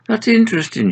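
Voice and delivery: a bright high character voice; high Agnes voice. Acted. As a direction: lift into Agnes's voice. Agnes voiced in read story.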